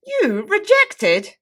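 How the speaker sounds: high, loud, drawn-out "You rejected?"; incredulous surprise. Acted. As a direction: stretch "You" way up in disbelief. Repeat with huge pitch jump.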